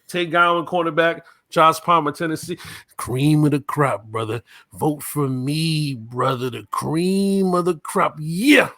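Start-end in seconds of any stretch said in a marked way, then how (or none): 3.0-8.7 Macho Man Randy Savage. Acted. Podcaster asking the group for votes, wrestler impersonation. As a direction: stretch the catchphrases like a pro wrestler. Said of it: "The Macho Man".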